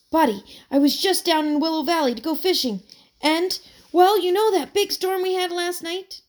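can't get it out fast enough; eager retelling. A narrator's reading. Boy tells his friend the storm news, eager and fast.

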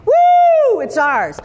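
high, slow, then a loud whoop; mock victory shout. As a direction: shout the win like a crowd.

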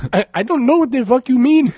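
high, loud put-on accent; mock Indian accent. Comedian quotes a movie character in a high mock accent. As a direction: bellow it in a hammed-up accent.

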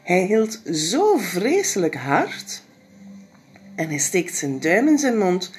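plain reading voice

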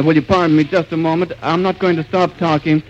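normal talking voice

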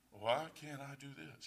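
quiet, calm imitation of Tom; lightly voiced calm friend. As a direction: mimic a calm, unbothered friend. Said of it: "He was all calm".

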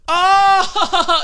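high, loud imitated "Oh!"; mock laughing gasp. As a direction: burst out loudly, imitating her realization. Acting out the woman's reaction.

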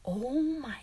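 whispered, drawn-out pity; stunned sympathy under the breath; hushed dismay. Retold or imitated. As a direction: whisper it slowly in disbelief. Reacting to the crying kid.

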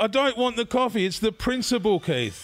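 high-pitched mock outrage; indignant protest. Storyteller retells refusing Keith's stolen coffee, indignant protest. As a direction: argue it as a comic retelling.

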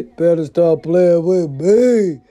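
slow, drawn-out threat, each word leaned on; slow menacing warning. Shown as a demonstration. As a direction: stretch it out like a final warning.

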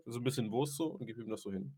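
usual speaking voice

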